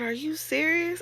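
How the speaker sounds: disbelief. Retold or imitated. Disbelief at boss news.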